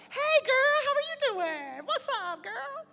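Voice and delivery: put-on very high, loud girly greeting; husband mimics wife's high excited hello; imitating his wife. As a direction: squeal it like his wife meeting a friend. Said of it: "I hear her stop".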